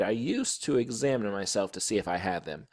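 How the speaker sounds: plain reading voice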